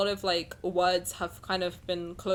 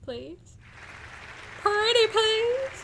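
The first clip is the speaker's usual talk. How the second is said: high, slow, cutesy begging; pretty please; 13 semitones higher, much slower than usual. Pretend. Begging a friend to say bless my heart, high slow wheedle. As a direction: stretch it out like a pleading child.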